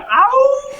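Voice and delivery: high howl; imitated howl. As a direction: let out a howl.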